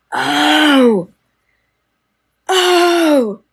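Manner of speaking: loud, high exclamation of delight; surprised cry. Real, not acted. Reacting to a gift from Debbie with a loud, high oh. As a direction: burst out with a sudden oh.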